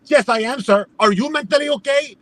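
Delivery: worked-up mock retort; freaking out. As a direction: imitate a clerk firing the question back. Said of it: "she starts freaking out".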